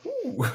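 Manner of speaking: high, soft "ooh"; imitated ooh. Contestant re-enacting turning to a teammate, impressed high ooh. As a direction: let out a quiet high-pitched impressed ooh. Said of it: "turning to you and going like".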